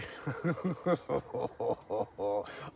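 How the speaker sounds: long helpless belly laugh; real laughter. Real, not acted. Amused by the cheek of it.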